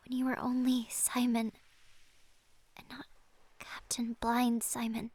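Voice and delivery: tender whisper. Acted. Lily whispering her love to Simon. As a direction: whisper it lovingly.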